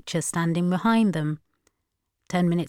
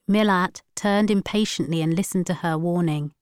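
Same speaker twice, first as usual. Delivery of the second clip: usual speaking voice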